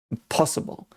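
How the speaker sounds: whispered emphatic repeat. Real, not acted. Explaining the training arc to viewers, whispered. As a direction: breathe the word out, no voice.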